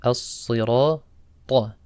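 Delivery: "As-sirata" slowly, thick s and emphatic t; heavy sad, ta. Shown as a demonstration. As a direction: stretch "As-sirata", dark s and crisp heavy t. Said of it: "Sad is a heavy letter".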